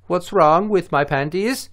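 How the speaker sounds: put-on high, slow, silly Dutch accent; mock Dutch accent. Alien woman questions puzzled astronaut, mock Dutch accent. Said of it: "I hope they sound like they're Dutch".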